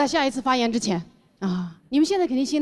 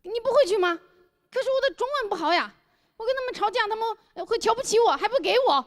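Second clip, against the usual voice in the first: much higher, plaintive foreign friend; pleading foreigner Thomas; 10 semitones higher than usual. Retold or imitated. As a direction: whine it like a helpless foreigner. 托马斯带口音的哀求. (托马斯可怜兮兮地求我)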